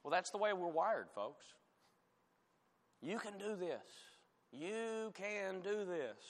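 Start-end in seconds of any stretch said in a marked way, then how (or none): 3.0-3.8 higher, softer mock encouragement; mock pep talk. Preacher mimicking talk of self-determination, mock pep talk. 4.5-6.2 drawn-out mock pep talk. Preacher mimicking talk of self-determination, drawn-out pep talk.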